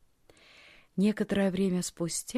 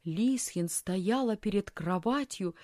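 usual speaking voice

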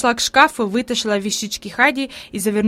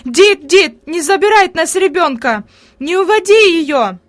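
higher, louder desperate calling; pleading shout; 6 semitones higher, 6 dB louder than usual. A narrator's reading. Grandmother shouts from the window to the aunt, pleading. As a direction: beg her from the window. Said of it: "умоляюще закричала".

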